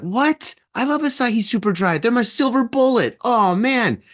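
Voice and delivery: high, outraged whine; indignant outburst. Retold or imitated. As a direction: squeal in betrayed disbelief. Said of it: "at first I was pissed".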